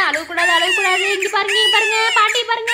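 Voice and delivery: put-on high sing-out to a wailing baby; grandma's high-pitched hushing; baby-soothing.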